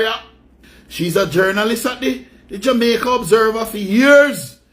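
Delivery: normal talking voice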